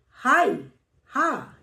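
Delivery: हाय, then हा sounded alone with weight; stress on हा. Shown as a demonstration. Teacher showing students where the weight falls, stressed हा. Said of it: "इसमें हा पे जोर है".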